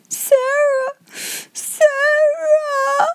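very high wailed call for help; child's wail. A narrator's reading. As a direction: stretch the name into a tearful, piercing cry. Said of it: "wailed Lottie".

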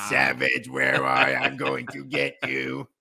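menacing villain voice. Shown as a demonstration. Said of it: "doing anything other".

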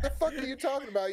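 high, fast, laughing through the words; can't stop laughing at his friend's story; laughing disbelief. Retold or imitated. To his friend, laughing in disbelief, high and fast. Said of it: "And I'm like".

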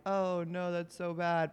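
lightly mocking faked concern; put-on "how awful" concern; fake sympathy. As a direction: imitate someone faking sympathy, a little softly. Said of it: "men who are fake".

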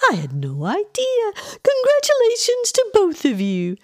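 very high, gushing congratulations; excited exclamation. A narrator's reading. Aunt congratulating a supposed expectant couple, excited squeal. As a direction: squeal it with delight, climbing very high. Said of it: "Edith exclaimed".

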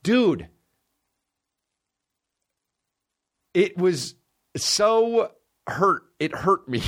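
high strained disbelief; pained high exclamation. Real, not acted. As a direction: voice shoots up, aching.

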